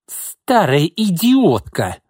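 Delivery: high, slow, drawn-out insult; stretched squeal of outrage; shrieking. A narrator's reading. Cornered mistress insults the old woman, shrieking. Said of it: "завизжала Изольда".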